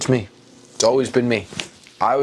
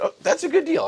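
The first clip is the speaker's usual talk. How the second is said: mock cheerful agreement. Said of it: "I said".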